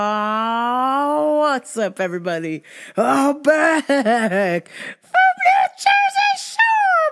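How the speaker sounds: high, loud hoarse greeting after yelling all weekend; wrecked voice. Real, not acted. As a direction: greet them with a voice shot from yelling. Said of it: "my voice is hella fucked up from yelling".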